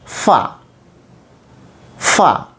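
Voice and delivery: "fa" said as a short, airy consonant demo, twice; letter sound fa. Shown as a demonstration. Phonics teacher showing pupils the next sound, breathy "fa". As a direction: breathe out "fa" with lip on teeth, pausing between takes. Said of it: "Next sound".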